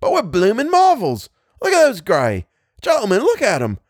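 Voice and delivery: high, amazed soldier's yell, climbing to a peak; excited bellowing. A narrator's reading. Soldier marvels at falling regiments, bellowing excitedly. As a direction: shout in wonder to your mate, voice pitched high. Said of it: "he bellowed to the man next to him".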